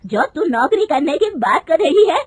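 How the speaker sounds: mother-in-law scolding her daughter-in-law, loud and angry. Acted. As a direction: rebuke her sharply and loud. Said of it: "गुस्से में कहती है".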